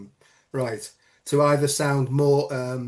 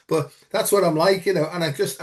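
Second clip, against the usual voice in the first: ordinary talk, nothing marked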